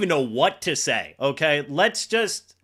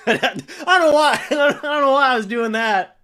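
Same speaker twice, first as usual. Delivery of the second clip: laughing; 6 semitones higher, 6 dB louder than usual. Real, not acted. Laughing at own outburst.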